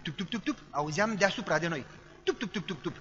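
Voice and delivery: ordinary delivery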